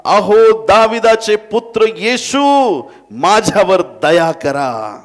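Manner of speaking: high, drawn-out desperate shout; loud crying out. Acted. Blind beggar calls to Jesus on the road, loud crying out. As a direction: call out at full voice, stretching every word. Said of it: "तो मोठमोठ्याने म्हणू लागला".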